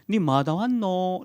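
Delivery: slow Gyeongsang dialect question, as God. Retold or imitated. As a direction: ask it slowly in Gyeongsang dialect. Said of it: "경상도 말로". (느린 경상도 사투리로 묻는 하나님)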